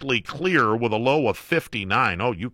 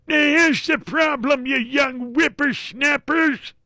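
put-on high, slow cranky old man; grandpa voice; slower than usual. Shown as a demonstration. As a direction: creak it out like a grumpy grandpa. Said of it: "gotta throw the grandpa voice in there".

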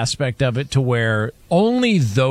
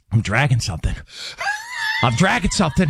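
mock exasperation, building to higher pitch; retold freak-out; 6 semitones higher, faster than usual. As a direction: imitate a dad about to blow a gasket. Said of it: "he just starts freaking out".

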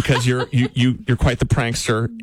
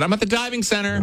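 higher, playful prank claim; fake phone excuse; 9 semitones higher than usual. Husband inventing a fake location for his wife, playful. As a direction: sell the silly alibi. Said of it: "So I should have said".

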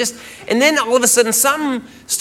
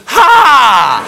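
loud, higher "ha" of ridicule; mocking laugh burst; 4 semitones higher, 7 dB louder than usual. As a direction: imitate a girlfriend's blurted mocking laugh. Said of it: "she looks down and she goes".

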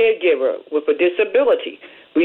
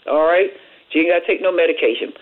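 normal talking voice

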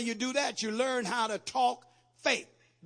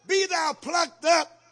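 declaimed command; 9 semitones higher, 10 dB louder, much slower than usual. Acted. Preacher declaiming scripture command.

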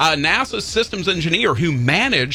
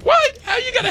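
surprised. Real, not acted. Host reacts in disbelief to cohost, high surprised shout. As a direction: jump up in disbelief.

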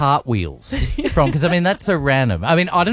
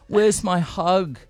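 put-on slow, higher whiny baby voice; mocking baby talk; 5 semitones higher, much slower than usual. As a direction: coo it like a needy bloke. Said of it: "but a bloke".

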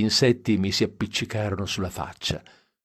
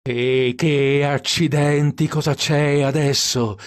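higher, louder, exasperated complaint; irritated outburst; 5 semitones higher, 4 dB louder, slower than usual. Acted. Dean curses the broken headlights, loud and exasperated. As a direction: snap at the dead headlights, slowly at first. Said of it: "si infuriò col cruscotto".

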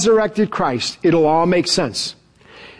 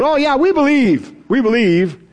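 mock eager, higher yes-men voice; eager agreement; 5 semitones higher than usual. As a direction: play the disciples suddenly gushing belief. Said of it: "all of a sudden changing their tune".

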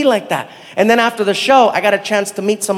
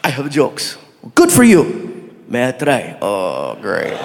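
put-on Saudi comedian accent; 9 semitones lower, slower than usual. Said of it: "I've been doing the stand-up comedy".

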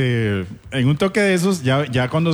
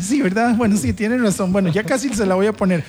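higher-pitched compliant employee; imitated obliging woman on the phone; Jessy; 6 semitones higher than usual. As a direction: give in to the boss, pitched up. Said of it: "este, Jessy".

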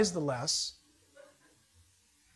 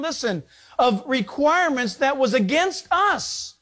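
louder, higher, slow preaching read; scripture reading; 5 semitones higher, 4 dB louder than usual. Acted. Preacher reading Colossians to the congregation, forceful slow proclamation. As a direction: proclaim the verse with weight.

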